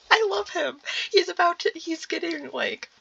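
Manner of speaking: high, delighted gushing. Real, not acted. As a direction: rave about him fondly. Reader gushing over the character.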